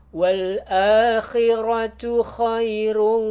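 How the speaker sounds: chanted tajwid recitation, high and loud; Quran recitation. Shown as a demonstration. Teacher chants the verse for students in recitation style. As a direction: recite the verse in full melodic tartil.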